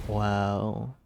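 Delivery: usual speaking voice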